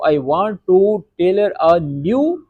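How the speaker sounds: very slow English, word by word; teacher giving slow translation. Shown as a demonstration. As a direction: read the English meaning out slowly. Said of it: "یعنی کہ".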